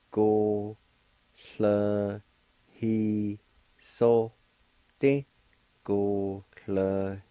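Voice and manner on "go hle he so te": "Go, hle, he, so, te" with each syllable set apart and a little louder. Shown as a demonstration. Teacher shows how amend is pronounced, syllable by syllable. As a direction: say "Go, hle, he, so, te" one syllable at a time with clear pauses. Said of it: "is pronounced like this".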